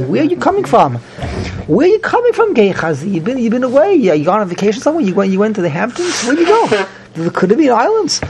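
high, mocking probing questions; sarcastic interrogation. Elisha grilling Gehazi about where he went, mocking and high. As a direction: needle him about the trip.

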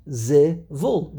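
zivul slowly split as zi, VUL; two separate syllables. Shown as a demonstration. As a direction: break zivul into two clear beats. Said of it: "There are only two syllables".

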